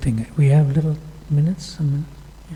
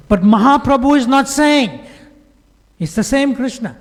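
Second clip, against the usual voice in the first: higher and louder, a firm point; forceful declaration; 10 semitones higher, 5 dB louder than usual. Retold or imitated. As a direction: proclaim it with full force. Guru's forceful reply.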